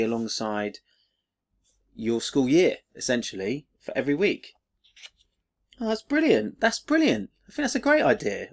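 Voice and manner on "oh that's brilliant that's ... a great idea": much higher, bubbling with delight; thrilled by the book; genuinely excited, pitch way up; real excitement. Real, not acted. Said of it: "I'm really genuinely excited by this".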